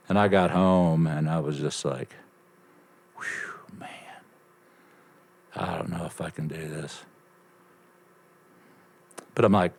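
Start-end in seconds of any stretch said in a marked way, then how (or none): 3.2-7.0 softly muttered self-doubt; worn-out sigh. Retold or imitated. To himself after a hard first workday, wearily hushed. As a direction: let it out low and soft, half breath.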